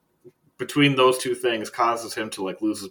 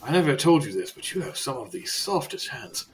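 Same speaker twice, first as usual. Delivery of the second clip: confiding in character, pitched up at first; guard's voice; 5 semitones higher than usual. Acted. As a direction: play a guard making an awkward confession. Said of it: "And he goes".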